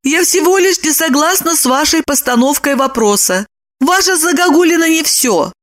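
high-pitched, gushing woman's voice; flattering Lipochka. Acted. Lipochka showers Roman with praise, flattering. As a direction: pile up compliments to soothe him.

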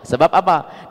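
normal talking voice

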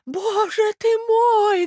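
very high, drawn-out lament; old prince, appalled. A narrator's reading. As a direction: wail to be taken away from here. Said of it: "говорил бедный князь". (старый князь, в ужасе, высоко причитает)

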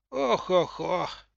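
put-on elderly sighing groans; old woman groaning. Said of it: "старчески заохов".

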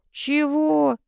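high, drawn-out query; frowning disbelief. A narrator's reading. Brother baffled by sister's demand, frowning. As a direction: question it with a lifted, displeased tone. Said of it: "нахмурился Ромка".

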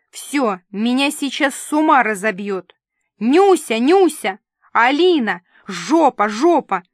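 loud shouted names and curses; frantic angry calling. A narrator's reading. Director calls Нюся and Алина, shouting in exasperation. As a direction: yell for help, exasperated.

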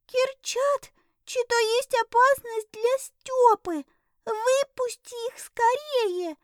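very high, urgent small cat voice. Acted. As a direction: squeak it out as a frightened cat. Кот Рыжик переводит лай. (высокий испуганный кошачий голос)

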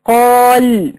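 قال held long, ending on a vowelless lam; drawn-out قال, closed on a bare l; stop with sukun. Shown as a demonstration. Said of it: "وقفت عليها، أصبحت اللام ساكنة".

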